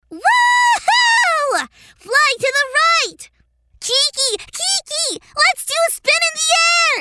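thrilled, high shouts while flying; excited shouting. Acted. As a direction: whoop with joy in the air. Flying on skateboard.

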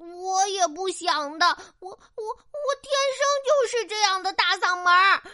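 high voice on the edge of tears; tearful. Acted. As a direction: stammer it out, hurt. Crow hurt, near tears. (委屈带哭腔)

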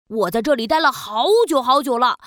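usual speaking voice

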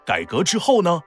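ordinary delivery, nothing marked

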